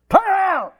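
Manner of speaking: high, loud yell of a father; dad's yell. Retold or imitated. Dad yelling at his daydreaming son, loud shout. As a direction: bark it across the field.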